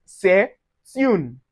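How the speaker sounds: "c'est une" as seh, tune; T of "c'est une" starts the next syllable; linked pronunciation. Shown as a demonstration. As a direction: pause after seh, then "une" with a T in "c'est une". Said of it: "it gives us".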